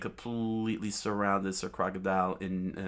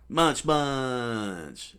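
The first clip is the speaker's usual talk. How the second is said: cartoon chomping; 5 semitones higher, 6 dB louder than usual. Chomping attack called out.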